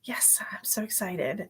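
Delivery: a conspiratorial whispered echo; hushed repeat of so excited; whispered excitement. Real, not acted.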